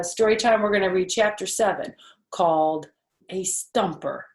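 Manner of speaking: plain reading voice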